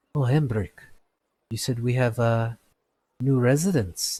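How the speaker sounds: lightly voiced surprised smith; second smith. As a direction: smith asking his partner about newcomers. GM voicing second smith Avi.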